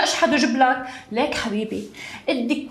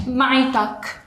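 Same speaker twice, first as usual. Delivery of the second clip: ordinary talk, nothing marked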